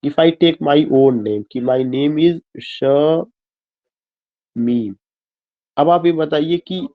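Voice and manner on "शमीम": शमीम broken as a long "sha", pause, then "meem"; "शमीम" slowly pulled apart into sha and meem; split into syllables. Shown as a demonstration. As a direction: say शमीम in two separate slow parts, sha ... meem. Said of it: "दो हिस्सों में टूट रहा है".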